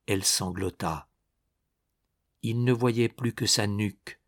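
ordinary delivery, nothing marked

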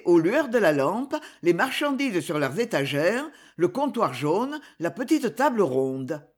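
ordinary delivery

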